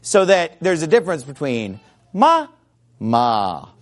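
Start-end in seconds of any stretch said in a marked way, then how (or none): 2.1-2.5 "ma" on a level tone; demonstrated tonal "ma", level pitch; tone demonstration. Shown as a demonstration. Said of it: "all the different ways you could say ma". 3.0-3.7 "ma" on a low tone; low-pitched tonal "ma"; tone demonstration. Shown as a demonstration. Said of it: "all the different ways you could say ma".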